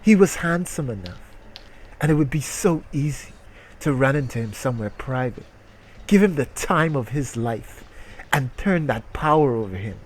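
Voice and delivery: usual speaking voice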